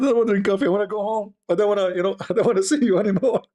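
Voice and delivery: high, fast, laughing scared-intruder voice; laughing mock whine. Telling how the scared intruder wanted to go home, laughing and high. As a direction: play the frightened intruder while laughing.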